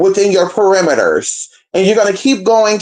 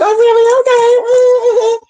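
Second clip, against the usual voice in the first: high, drawn-out sarcastic oh really; mock surprise; much slower than usual. To the brother, a high sarcastic oh really. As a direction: fake interest stretched way up high. Skeptical reaction to brother's complaint.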